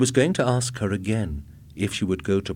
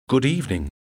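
higher, uneasy greeting; nervous man greeting a robot; nervous reply; 5 semitones higher, 3 dB louder, faster than usual. A narrator's reading. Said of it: "He was trembling".

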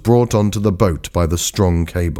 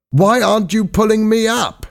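high-pitched, urgent protest; alarmed demand. A narrator's reading. Trapped diver demands rescue.

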